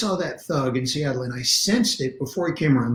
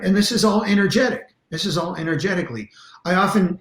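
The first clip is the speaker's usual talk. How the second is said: ordinary talk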